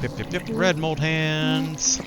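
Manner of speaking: ordinary talk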